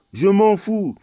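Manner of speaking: loud, high burst of indifference; exasperated shout. Real, not acted. Ranter dismissing talk of origins, exasperated shout. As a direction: shout it out, fed up. Emphatic repeat in an angry rant.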